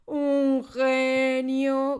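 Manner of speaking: very loud, high, drawn-out outraged shout; slow, swelling outraged yell. Acted. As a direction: explode in disbelief. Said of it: "gritó".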